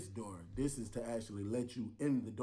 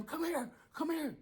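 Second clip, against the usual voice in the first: high retold yell, calling her over; screaming. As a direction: call urgently to the officer. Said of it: "we go to scream".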